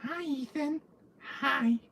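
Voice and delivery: lightly voiced eerie high sweet greeting; a hint of the possessed wife cooing his name; mock creepy singsong hello. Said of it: "It's like".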